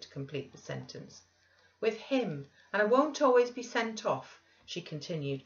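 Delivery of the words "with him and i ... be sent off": higher, heated protest; kindling up. A narrator's reading. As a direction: blurt it out with rising indignation. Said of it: "kindling up".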